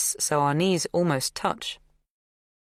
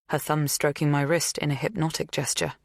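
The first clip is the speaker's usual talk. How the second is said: ordinary delivery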